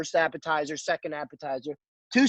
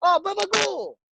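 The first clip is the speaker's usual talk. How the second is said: mock Italian-American curse; 13 semitones higher than usual. Customer cursing the cook who blocked him, mock outburst. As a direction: imitate an indignant customer cursing, pitched way up.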